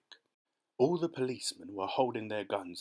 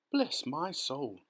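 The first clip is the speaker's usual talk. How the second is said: slow, higher-pitched amazement; astonished cry; 4 semitones higher, much slower than usual. A narrator's reading. Fire chief spots a little boy, amazed cry. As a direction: draw out the words in wonder. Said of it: "cried the head of the fire department".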